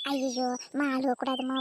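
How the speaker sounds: ordinary talk, nothing marked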